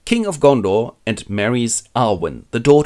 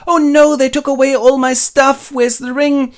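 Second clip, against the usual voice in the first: much higher and louder, whiny alarm; mock distressed Frodo; 14 semitones higher, 6 dB louder than usual. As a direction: squeal it like a frantic hobbit. Frodo's lines voiced.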